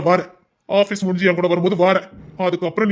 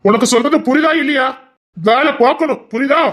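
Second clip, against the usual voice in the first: raised, louder scolding of the wife; stern scolding; 6 semitones higher, 4 dB louder than usual. Acted. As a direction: lay down the law, firmly. Husband scolds idle wife. (மனைவியை கண்டிக்கும் கணவன், கோபமான குரலில்)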